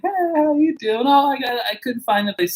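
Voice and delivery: high, bubbly greeting; cute realtor. Retold or imitated.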